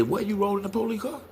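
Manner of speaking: high, fast disbelieving question; incredulous. Retold or imitated. Uncle hearing nephew rode with police, incredulous. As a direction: fire it off in shock.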